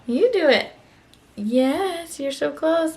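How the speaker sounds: sing-song encouragement for a little one; high encouraging. Real, not acted. Encouraging toddler to sign.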